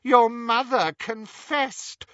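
high, slow sneer. Acted. Riddle Harry tormenting Ron about his mother, sneering. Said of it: "sneered Riddle Harry".

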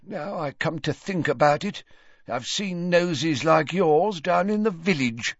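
put-on needling old man's jibe. A narrator's reading. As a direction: say it meaning to offend. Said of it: "supposed to be an offensive tone".

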